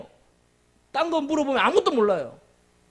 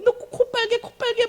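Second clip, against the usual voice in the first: high singsong mock teasing; mocking taunt; 11 semitones higher than usual. Kids jeering at Rudolph about his red nose, high mocking singsong. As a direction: taunt him like a schoolyard bully.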